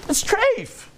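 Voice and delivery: high, mildly angry retort; a little anger. Acted. Rabbi answering the student's question, a little angry. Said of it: "with a little bit of anger".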